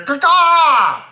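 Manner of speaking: high, loud bird caw; hawk screech. Pretend. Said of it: "I can't do an eagle sound".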